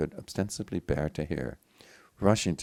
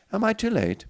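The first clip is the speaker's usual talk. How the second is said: anxious; 9 dB louder than usual. Acted. Injured son asks anxiously.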